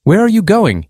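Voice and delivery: lightly voiced stranger calling after her; narrated shout. A narrator's reading. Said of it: "he shouted at her".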